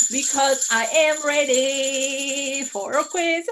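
slow, showy quiz-host voice; hammy slow host voice; put-on host voice. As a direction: announce it like a game show host, slowly. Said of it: "I'm talking like this".

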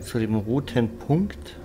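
normal talking voice

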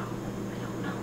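whispered confusion. Real, not acted. Can't tell what the product is.

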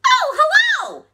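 very high, loud tiny-bug greeting; ladybug voice. A narrator's reading. Ladybug greeting bear back, high and friendly. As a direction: squeal a friendly hello back.

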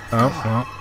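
ordinary talk, nothing marked

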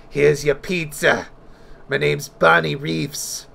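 slow, drawn-out lunch lady voice; cafeteria lady voice. Retold or imitated. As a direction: play a gruff lunch lady serving pizza. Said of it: "like a cafeteria lady".

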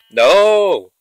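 loud sudden cry of dismay; startled shout; alarmed no. Real, not acted. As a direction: blurt it out as something goes wrong. Sudden danger in game.

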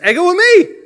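loud, high-pitched declaration; big mock-dramatic shout; emphatic proclamation. Quotes Jesus' Greek emphatically.

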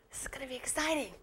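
fast whispered "This is gonna be exciting."; excited whisper. Acted. Teacher to pupils before writing, excited whisper. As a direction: whisper it like a secret thrill.

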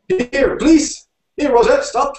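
high, loud, desperate yell; sudden yelling. A narrator's reading. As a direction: yell frantically for a halt. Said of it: "suddenly the driver yelled".

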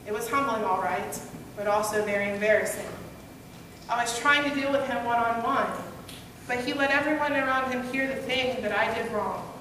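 ordinary talk

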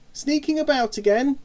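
high-pitched brotherly call-out; taunting shout. A narrator's reading. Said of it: "shouted Simon".

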